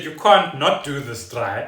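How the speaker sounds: usual speaking voice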